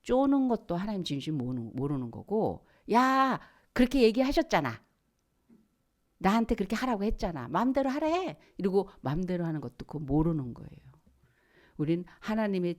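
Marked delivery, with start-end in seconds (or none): 2.9-8.4 high-pitched mock arguing back; indignant protest. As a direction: throw the words back in protest. 따지는 백성 흉내. (따지고 드는 말투 흉내)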